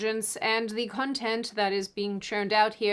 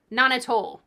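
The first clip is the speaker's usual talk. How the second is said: ordinary talk, nothing marked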